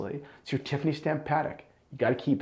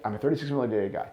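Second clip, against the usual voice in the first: a rushed aside, as if everyone already knows; quick throwaway repeat; 3 dB quieter, much faster than usual. Shown as a demonstration. Watch reviewer to viewers, a fast muttered self-quote. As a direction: rattle it off fast and softer, in a different timbre. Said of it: "and everyone knows it".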